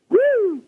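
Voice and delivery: a high, loud siren whoop. Retold or imitated. Telling how Hoover police pulled him over, siren whoop. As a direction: mimic the police siren coming on.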